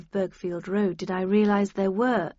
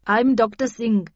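brisk, louder pathologist introducing herself; lightly accented Indian pathologist; Indian woman pathologist; 2 semitones higher, 6 dB louder than usual. Acted. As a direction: voice a matter-of-fact doctor.

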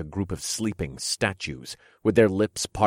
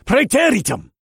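shouted spell; 9 dB louder than usual. Acted. Casting a spell.

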